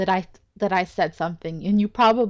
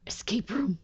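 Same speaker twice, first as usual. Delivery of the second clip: whisper; 7 dB quieter than usual. Real, not acted. Aside on the hidden escape.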